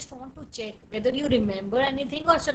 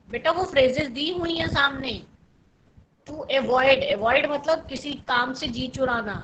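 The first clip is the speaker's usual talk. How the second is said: ordinary delivery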